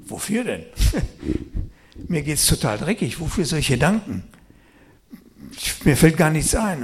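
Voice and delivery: loud, exasperated complaining; retold protest. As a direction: protest at full volume that there is nothing to be thankful for. (laute, genervte Klage)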